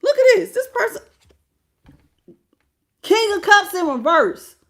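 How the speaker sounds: high and loud, outraged. Real, not acted. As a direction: jump up in pitch at the card. Outraged reaction to tarot card.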